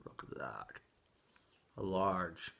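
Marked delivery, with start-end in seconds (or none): 0.0-0.7 hushed awe; whisper. Real, not acted. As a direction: breathe it out in wonder. Admiring new pipe.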